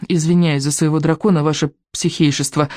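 ordinary delivery, nothing marked

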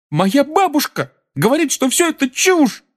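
very high squeaky exclamation; falsetto cry. A narrator's reading. Neville quoting his grandmother to the boys, falsetto cry. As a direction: pipe up in falsetto. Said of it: "крикнул Невилл фальцетом".

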